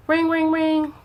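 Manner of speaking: mock phone ring. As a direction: imitate a ringing phone with the voice. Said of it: "Acting like somebody calling me".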